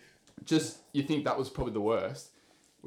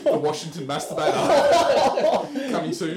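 slow, louder, higher mock movie-trailer title; hammed-up Netflix title read; mock trailer announcer; 6 semitones higher, 9 dB louder, slower than usual. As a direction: announce it like a true-crime doco.

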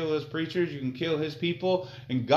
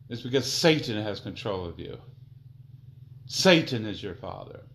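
ordinary talk, nothing marked

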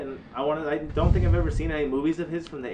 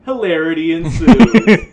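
slow, louder, drawn-out hammed-up announcement; mock dramatic announcement; 5 semitones higher, 15 dB louder, slower than usual. Announcing his movie synopsis to his cohost, slow, loud and hammed-up. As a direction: boom it out like a movie trailer. Comic punchline delivery.

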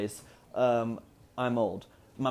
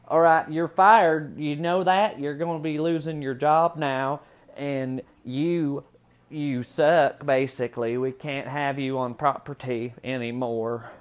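louder, higher put-on hillbilly twang; hillbilly accent; 3 semitones higher, 5 dB louder, slower than usual. Hillbilly boss firing an employee, mock twang. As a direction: fire them like a hammed-up hillbilly boss. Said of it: "how does a hillbilly".

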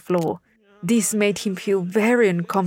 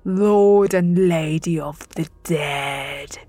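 menacing god. Acted. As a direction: dread lord of the dead. God of the dead rebukes intruder.

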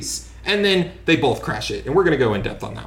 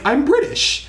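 high-pitched mock claim; mocking, pitched far up; mocking imitation; 12 semitones higher than usual. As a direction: mimic a hopelessly unconvincing actress. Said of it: "She's just like".

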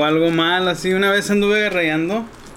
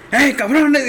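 higher mock call-out; grito imitado; 8 semitones higher, 4 dB louder, slower than usual. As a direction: catch them red-handed with a hollered "hey". Imita al que los descubre. (grito imitado, más agudo)

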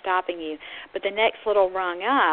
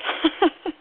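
short high chuckle; brief self-amused laugh; 9 semitones higher, 3 dB louder than usual. Real, not acted. Lecturer amused at asking listeners to answer out loud, laughing. Laughs at own participation request.

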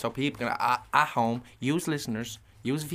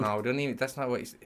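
ordinary delivery

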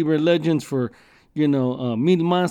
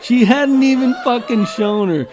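laughing it out, higher and louder; 6 semitones higher, 5 dB louder than usual. Real, not acted. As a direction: crack up at the punchline. Laughing at the punchline.

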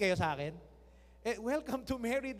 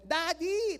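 mock child calling; 6 semitones higher, 5 dB louder than usual. Child calling dad, imitated.

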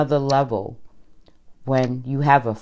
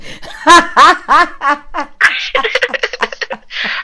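loud high laugh; 13 semitones higher, 6 dB louder than usual. Real, not acted. Laughs at big man line.

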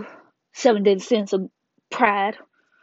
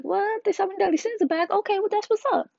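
high, surprised reaction voice; surprised crowd; 11 semitones higher than usual. Retold or imitated. The crowd reacting to the Sins coming back, high and surprised. Everybody reacts to Sins' return.

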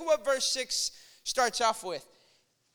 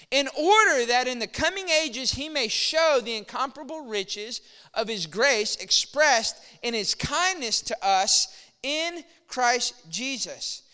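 ordinary delivery

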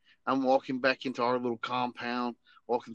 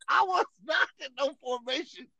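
higher, disbelieving run of questions; incredulous protest; 9 semitones higher than usual. Retold or imitated. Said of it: "And I was like".

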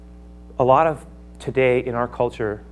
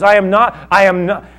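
high, loud denial; Paul firmly rejecting the charge; loud protest; 6 semitones higher, 8 dB louder than usual. Acted. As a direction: insist you are not mad. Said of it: "He says".

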